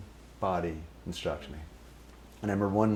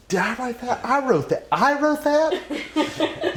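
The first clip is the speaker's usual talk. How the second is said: much higher, louder, stretched out incredulity; high mock disbelief; 7 dB louder, slower than usual. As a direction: play up the disbelief. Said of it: "And he goes".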